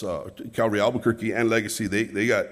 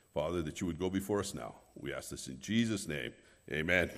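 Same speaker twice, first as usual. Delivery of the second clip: prayer; 4 semitones lower, 6 dB quieter than usual. Acted. Leads a prayer from pulpit.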